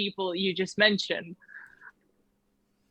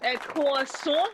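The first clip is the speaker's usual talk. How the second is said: slow, higher French-accented question; 4 semitones higher, slower than usual. Shown as a demonstration. Friend demonstrating the order slowly, French accent. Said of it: "you have to say".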